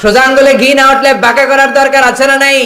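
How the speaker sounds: high, loud shouted sermon question; fiery preacher's shout; full-force preaching. Acted. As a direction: hurl the question at the crowd.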